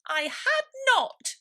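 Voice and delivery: very high, loud, slow; angry mouse cry. A narrator's reading. Mouse crying out at Alice, high furious squeak. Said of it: "cried the mouse sharply and very angrily".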